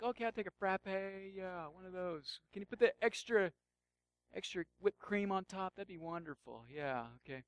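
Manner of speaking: softly voiced, indulgent ordering; mock coffee customer. Customer ordering a frappe, softly self-indulgent. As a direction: order a treat in a hushed, pleased murmur.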